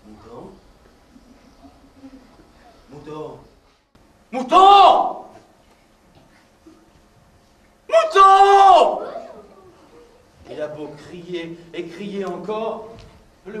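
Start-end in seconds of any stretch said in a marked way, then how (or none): 0.1-3.4 low, soft, uncertain calls; quiet calling. Acted. The wolf softly calls for the vanished sheep. 4.3-9.0 high, loud shout across the field; shouted calling. Acted. The wolf yells for the sheep that ran off, loud.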